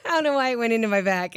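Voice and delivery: giggling through the words; laughing. Real, not acted. Vlogger laughing at her own back pain. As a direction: laugh it out, amused at herself.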